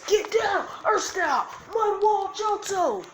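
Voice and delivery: high, loud anime attack shout; battle cry. Acted. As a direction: yell the jutsu name, last words drawn out. Acted anime attack call.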